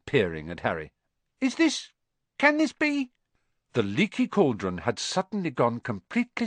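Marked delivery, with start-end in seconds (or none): 1.4-3.1 much higher, breathless amazement; awed disbelief. Acted. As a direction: hardly dare to ask it. Barman recognizes Harry.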